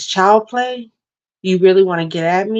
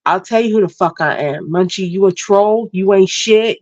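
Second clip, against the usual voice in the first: ordinary delivery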